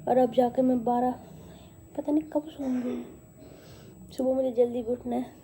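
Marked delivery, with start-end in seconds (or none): 2.0-3.0 soft late-night whisper; whispering tiredly at midnight. Real, not acted. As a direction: whisper it so nobody wakes. Late night, everyone asleep.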